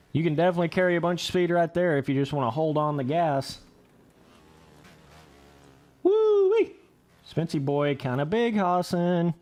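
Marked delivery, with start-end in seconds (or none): 6.0-6.8 excited whoop. Real, not acted. Excited whoop after big jump.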